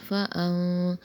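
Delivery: فَأَن with the nun turned into m before ba; فَأَن as fam; iqlab. Shown as a demonstration. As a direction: say فَأَن as if fam, lips closing. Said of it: "اقلاب کا قاعدہ ہوگا".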